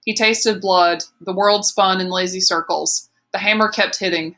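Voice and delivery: usual speaking voice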